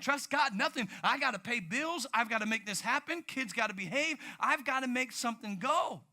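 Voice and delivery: mock protest about the bills; frustrated. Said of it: "You're like".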